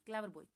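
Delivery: क्लेवर बॉय starting on a k sound; क्लेवर begun with a plain k; pronunciation model. Shown as a demonstration. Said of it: "क्या बोलेंगे".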